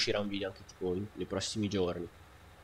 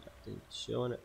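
ordinary talk, nothing marked

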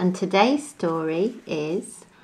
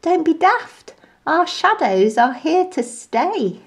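high, amused put-down; cheeky monkey teasing; scoffing monkey; 9 semitones higher than usual. A narrator's reading. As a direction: laugh off his fear. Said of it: "laughed and said".

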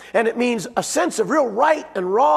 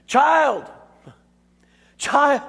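put-on Child said high and loud; the word Child hammered home; stress on child; 6 semitones higher, 3 dB louder than usual. Shown as a demonstration. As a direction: punch the word Child, alone. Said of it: "The accent's all on the child".

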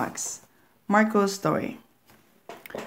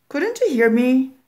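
higher, louder puzzled mother; mother explaining, raised and higher; mother's voice; 7 semitones higher, 5 dB louder than usual. Acted. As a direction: mom calling to the kids. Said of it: "The voice continued".

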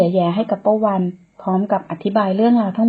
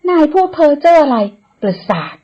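higher, louder scoff that drops off at the end; annoyed retort; 7 semitones higher, 5 dB louder than usual. Acted. Woman scoffs at the man's ghost talk, annoyed. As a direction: brush off his nonsense sharply. Woman snaps back at him.

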